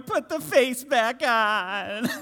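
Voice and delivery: put-on slow, high pleading kid voice through a laugh; scared child. Pastor imitating son begging from the closet, high and slow. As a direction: play the scared little boy, slow and pleading.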